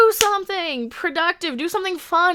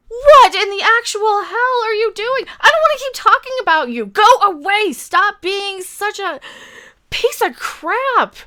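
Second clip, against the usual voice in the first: raised, exasperated telling-off; angry rant; 5 semitones higher, 4 dB louder than usual. Acted. Ranting at the actress, angry and raised. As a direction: tear into her, voice up and loud.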